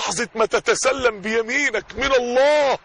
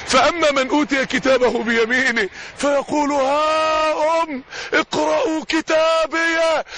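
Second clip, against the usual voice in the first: slow, soaring chant, higher at the end; Quran recitation; 4 semitones higher, slower than usual. Acted. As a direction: call out the verse in a drawn-out recitation.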